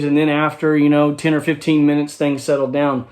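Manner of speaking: ordinary talk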